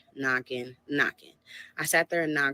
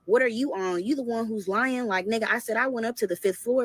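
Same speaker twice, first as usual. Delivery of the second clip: higher, heated comeback; angry confronting; 6 semitones higher, 7 dB louder than usual. Retold or imitated. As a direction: snap back at the liar. She confronts him in car.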